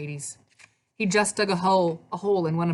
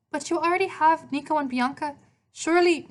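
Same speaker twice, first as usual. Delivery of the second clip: much higher, protesting Italian woman; Italian accent; 10 semitones higher than usual. A narrator's reading. Maria objecting to Hades about their children, Italian accent. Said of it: "she spoke with an Italian accent".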